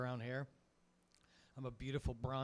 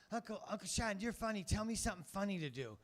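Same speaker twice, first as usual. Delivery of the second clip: put-on higher pleading child voice; kid voice; 6 semitones higher than usual. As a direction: beg like a little boy, higher. Nephew voiced in story.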